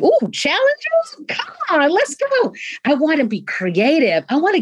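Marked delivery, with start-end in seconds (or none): 0.0-2.5 much higher, slow, gleeful eagerness; eager for challenges. Pretend. Leader imagining meeting stress with joy, gleeful and much higher. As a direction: relish the challenge like a thrilled kid. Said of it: "I'd wanna be like".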